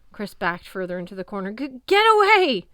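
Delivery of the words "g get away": high, loud, slow panicked yell; cornered and shrieking; terrified stammer. A narrator's reading. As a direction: stutter out the fear. Chris backing into corner.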